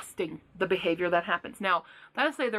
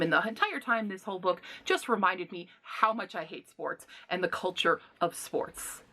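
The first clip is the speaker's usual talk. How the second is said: ordinary talk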